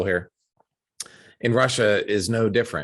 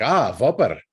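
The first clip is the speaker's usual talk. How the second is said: put-on higher, Russian-accented "Ah, Whopper"; worker's realization, imitated; 4 semitones higher, 4 dB louder than usual. Worker finally understanding the order, imitated Russian-accented realization. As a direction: suddenly get it, rolling the final r. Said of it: "And he was like".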